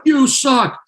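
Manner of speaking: high-pitched mock heckle; heckling audience. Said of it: "the audience is like".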